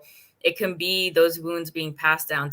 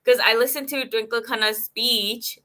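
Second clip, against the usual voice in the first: light Indian accent slipping in, a bit higher; 5 semitones higher than usual. Real, not acted. Host recalling Padman speech slips into light Indian accent. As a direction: let a hint of Indian accent creep in unplanned. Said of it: "There's my Indian accent coming out".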